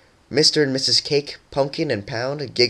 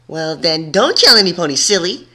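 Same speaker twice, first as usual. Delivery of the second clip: bright, louder playful teasing; cheerful; 7 semitones higher, 6 dB louder than usual. A narrator's reading. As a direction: tease her playfully. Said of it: "Surprise responded".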